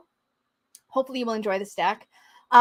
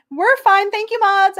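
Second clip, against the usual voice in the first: excited thanks; 9 semitones higher, 8 dB louder, slower than usual. Real, not acted. Reacting to chat spam.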